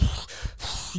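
huffing out air like an irritated bull; wordless snorting sigh. Retold or imitated. Friend imitating the angry diner's bull stuff, huffy snort. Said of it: "doing his bull stuff".

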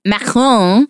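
macaron said the French way; French pronunciation. Shown as a demonstration. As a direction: pronounce macaron with a French accent. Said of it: "Please stop saying it like that".

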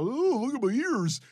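high, lightly voiced beagle, cute and innocent; voicing the dog. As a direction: give the dog a silly cute voice. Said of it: "you know, Beagle".

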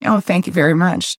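ordinary talk

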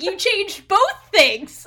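loud, high, slow exclamation; mock outrage. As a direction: drag out each word in indignant disbelief. Outraged retold reaction to pronunciation.

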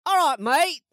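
high, broad mock Australian; Australian accent. Asked to give Australian, high hammed-up Australian accent. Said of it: "Give me Australian".

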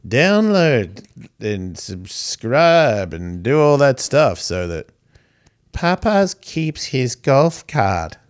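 slow, stretched-out pretend cat speech; voicing the cat. Podcaster speaks for his purring cat, slow mock plea. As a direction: drag out the words as if the cat is pleading. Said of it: "And he says".

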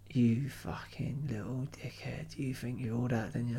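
put-on low, slow, hushed drunken menace; drunk Clive. Drunk pub regular threatening the barman who challenged him, slurred and low. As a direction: slur it quietly under your breath as a threat. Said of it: "he's had too many to drink".

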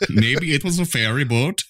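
hammed-up Scandinavian voice; mock Scandinavian accent. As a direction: announce the crash in a silly Nordic accent. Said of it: "good impressions".

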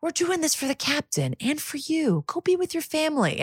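high, gentle placating voice; imitated soothing. Colleague calming a man who stormed off, imitated soothing. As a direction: talk him down softly, pitch held well up. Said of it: "ability to completely, like, diffuse".

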